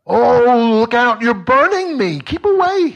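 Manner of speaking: shrieking high and loud in pain; panicked scream. A narrator's reading. Ghost cries out as the angel burns him, panicked shriek. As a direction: recoil shrieking from the burning hands.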